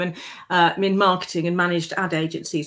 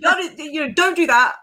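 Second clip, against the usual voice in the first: a higher, mocking imitation of the ex-husband nagging; 7 semitones higher than usual. Wife mimicking her first husband's nagging, high and mocking. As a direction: parrot him, pitched up and whiny. Husband voiced in retold story.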